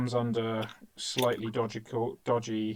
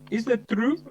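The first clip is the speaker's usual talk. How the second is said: mock girls asking; 11 semitones higher, 5 dB louder than usual. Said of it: "they look at me and they say".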